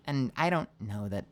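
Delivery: ordinary delivery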